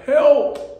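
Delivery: high, loud plea of despair; desperate cry for help. Retold or imitated. Father alone in despair cries to God, high loud plea. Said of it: "Just despair".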